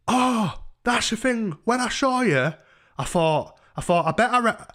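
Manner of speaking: high, loud imitation of a tipsy exclamation; mock drunk chatter. Overheard talk to a man downstairs, mimicked high and loud.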